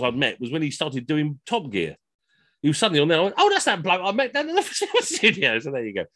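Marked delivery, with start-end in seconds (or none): 3.4-5.6 high, excited cry of recognition; mock-amazed yelp, breaking into a laugh; sudden realisation. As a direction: blurt it out as the penny drops. Sudden realisation watching television.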